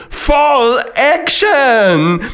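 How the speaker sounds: high, drawn-out whoop; excited shout. A narrator's reading. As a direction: yell it out long and high. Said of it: "er schreit".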